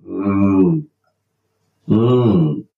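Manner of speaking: contented mmm over coffee; appreciative mmm; savouring hum. Real, not acted. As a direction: hum like tasting good coffee.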